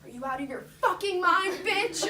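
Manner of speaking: high, loud mock yell; imitating a yell. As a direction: play the mom screaming at the stranger. Mom yelling at the woman.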